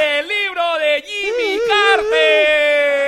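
high, loud, long-held vowels; over-the-top Spanish announcer holler; Spanish announcer shout. Pretend. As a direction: draw out the prize like a goal call. Mock Spanish announcer names the prize.

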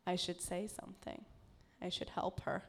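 hushed, soft inner thought; quiet inner voice. Retold or imitated. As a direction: murmur it to yourself. Imagined catcaller's inner thoughts.

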